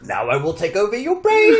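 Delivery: high, loud cartoon villain. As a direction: gloat like a mind-controlling monster. Voicing brain-taking coffee.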